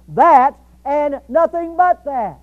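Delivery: THAT punched loud and drawn out, each following word weighted; emphatic. Shown as a demonstration. Preacher translates the Greek emphatic, slow heavy stress on THAT. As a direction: slowly hammer out "that and nothing but that". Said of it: "In the emphatic sense".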